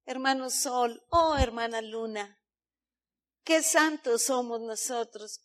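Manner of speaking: a hint of syrupy false holiness; mock sanctimony. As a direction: coo like a smug saint. Mocking self-satisfied holiness.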